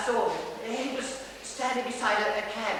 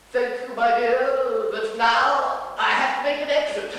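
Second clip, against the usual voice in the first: loud, grand actor-manager, higher and drawn out; winning smile; 3 semitones higher, 7 dB louder than usual. Acted. Dresser playing Sir to an admiring woman, grandly gracious. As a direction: sing out a gracious stage farewell. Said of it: "winning smile that he's got and said".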